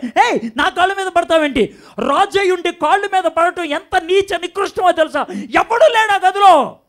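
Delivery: a king scandalised that someone is at his feet, building to a yell; outraged king shouting for his servants; indignant royal outburst. Acted. King David outraged at prophet.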